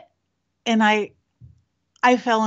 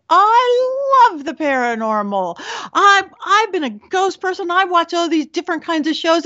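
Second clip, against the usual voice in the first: higher, gushing fan voice; imitating the excited client; 6 semitones higher than usual. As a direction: gush like a thrilled ghost fan. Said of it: "She's like".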